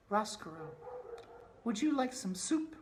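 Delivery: high princess voice offering soup; gentle princess voice. Acted.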